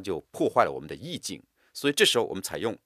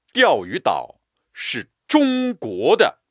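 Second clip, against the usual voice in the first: 中国 declared higher, loud and slow; stress on 中国; 7 semitones higher, 7 dB louder, slower than usual. Shown as a demonstration. Teacher declaring firmly, stress on 中国. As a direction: insist on 中国. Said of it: "为了强调是中国的".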